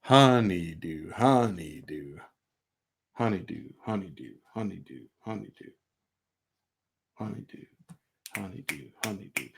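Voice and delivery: "honeydew" with "dew" held long, fading to soft; holding the dew. Shown as a demonstration. Teacher chanting honeydew, holding the dew out. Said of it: "holding the dew out".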